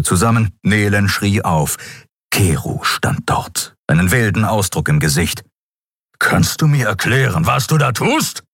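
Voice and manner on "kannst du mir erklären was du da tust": higher-pitched, agitated challenge; agitated demand. A narrator's reading. Keru bursts onto the bridge demanding answers, agitated. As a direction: demand an explanation in alarm. Angry man confronts the pilot.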